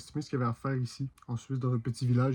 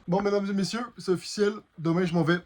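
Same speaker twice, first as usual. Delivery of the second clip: mock announcer; 7 semitones higher than usual.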